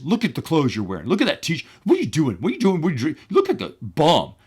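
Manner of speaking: imitated indignant boss, rising in pitch; mock-outraged dressing-down; insulting rant. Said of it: "just totally insulted the guy".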